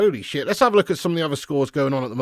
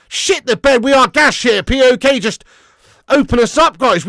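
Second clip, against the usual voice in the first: loud, high exasperated yelling at the match; frustrated shout; 8 semitones higher, 10 dB louder than usual. Real, not acted. Streamer yelling at his team's defending, loud and high. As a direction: bellow at the defence in disbelief. Reacting live to bad defending.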